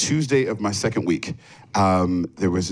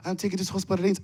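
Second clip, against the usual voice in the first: put-on high, soft little French girl's voice; small, high-pitched French-accented piping; tiny French accent; 9 semitones higher, 5 dB quieter, faster than usual. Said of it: "her little French accent, very tiny voice".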